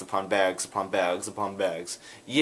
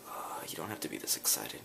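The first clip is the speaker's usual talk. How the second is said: soft deadpan reply; 6 semitones lower, 12 dB quieter than usual. Retold or imitated. Relatives calming him down.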